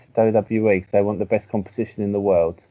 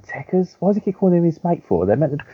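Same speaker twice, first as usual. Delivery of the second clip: baffled audience; 7 semitones higher than usual. Retold or imitated.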